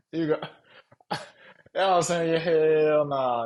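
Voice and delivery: can't get it out for laughing. Real, not acted. Streamer cracking up at a viewer's question, laughing.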